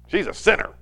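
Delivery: a hint of a scornful host; lightly voiced judgmental host, pointed; host's sneer. Pastor voicing the host judging the woman, lightly scornful. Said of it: "looking at each other and goes".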